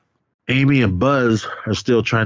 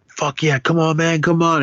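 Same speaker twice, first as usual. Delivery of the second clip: higher-pitched, eager egging-on; mock goading; 8 semitones higher than usual. Playing Marshall waiting with the axe, mock goading. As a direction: imitate a hyped-up guy daring someone closer.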